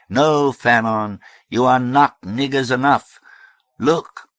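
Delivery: loud, high pulpit voice; fiery preaching. A narrator's reading. Reverend Amy preaching to the hall, fiery. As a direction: ring it out to a crowd.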